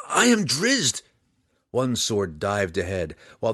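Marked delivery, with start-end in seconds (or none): 0.1-1.0 high, loud, urgent shout. A narrator's reading. Drizzt to the father attacking him, shouting urgently. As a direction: shout it to make him recognize you. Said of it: "he shouted".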